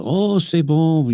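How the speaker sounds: imitating a French chef, high and slow; French accent. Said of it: "with an accent".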